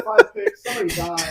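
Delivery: high, loud, laughing talk; laughing exaggeration. Real, not acted. Piling on jokes about the player, loud and laughing. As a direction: toss it out while cracking up.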